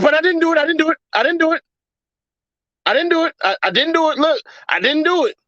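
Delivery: high, hurried begging in a put-on voice; mock pleading denial. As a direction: plead your innocence over and over. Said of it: "And he just, uh".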